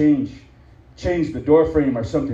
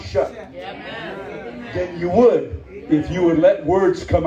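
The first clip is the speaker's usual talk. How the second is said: rising preaching; 8 semitones higher, 3 dB louder than usual. Acted. Preacher telling mouthy members silence is strength, rising preaching. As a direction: drive the point home, climbing.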